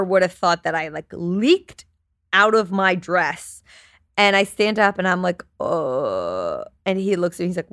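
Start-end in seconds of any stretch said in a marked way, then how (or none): 5.6-6.6 long, very low groan; drawn-out low sound of dread; dismayed "uh". Retold or imitated. Wife standing up from the stained chair, long low dismayed groan.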